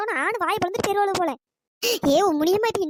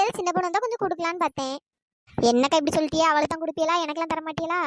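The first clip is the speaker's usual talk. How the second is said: ordinary talk, nothing marked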